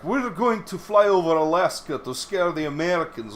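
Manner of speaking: mildly mocking the Russians' bluster; mock Russians. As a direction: tease the Russians' showing off. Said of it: "it's like".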